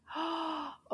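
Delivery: soft gasp of wonder; quick quiet intake of surprise; surprised gasp. Pretend. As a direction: gasp at the next picture. Reveals next picture to children.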